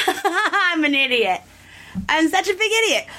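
high-pitched laughing self-mockery. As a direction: laugh at yourself, voice jumping up high. Mocking own past fright, laughing.

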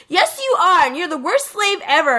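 high, teasing comeback; mock arguing boy. Friend acting out the bickering reply, high teasing comeback. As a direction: fire back like an anime squabble.

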